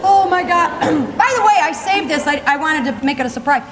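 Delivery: ordinary delivery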